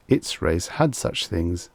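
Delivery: ordinary delivery, nothing marked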